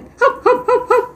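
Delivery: very high, yelping hap-hap bursts; mock barking laugh. A narrator's reading. Parker laughing till he cries, high mock barking laugh. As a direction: imitate a helpless laugh as high yelps. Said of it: "پارکر صدا کرد".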